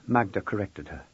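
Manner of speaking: ordinary delivery, nothing marked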